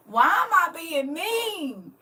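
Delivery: high, slow, drawn-out mock wail; hammed-up exasperated plea; self-reproach. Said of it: "I'll be like".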